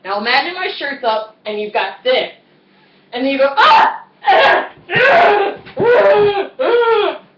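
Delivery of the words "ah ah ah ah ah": high, loud, strained mock screams; repeated straining yells while acting out being stuck; reenacted struggling screams. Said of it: "that I'm screaming".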